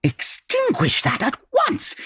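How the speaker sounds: high, sharp order; startled high-pitched command; alarmed command. Acted. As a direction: snap an urgent order to put it out. Alarmed by the blinding phone light.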